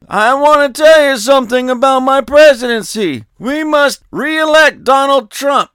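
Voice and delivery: loud, high mock Biden speech; Joe Biden impression. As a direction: declare it grandly like a muddled old president. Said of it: "he actually said that".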